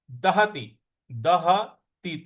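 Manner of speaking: दहति said slowly, each syllable da-ha-ti apart; pronunciation demo. Shown as a demonstration. Teacher shows students how दहति sounds, slow and syllabic. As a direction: spell out दहति syllable by syllable, short i at the end. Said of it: "त पर छोटी की मात्रा है".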